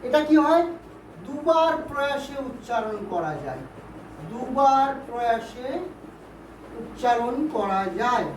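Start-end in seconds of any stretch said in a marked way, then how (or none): 4.3-8.3 slow, split into short phrases with pauses; slow dictation. Shown as a demonstration. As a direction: dictate it slowly for students writing it down. Teacher repeats definition for note-taking.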